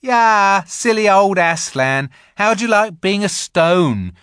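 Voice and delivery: high, loud mocking taunt; jeering Edmund. A narrator's reading. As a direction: jeer at the stone lion. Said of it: "Then he said".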